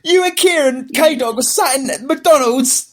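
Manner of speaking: rising, squeaky mocking read; mocking the message. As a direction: parody the friend's gloating text. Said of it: "this bullshit coming over saying".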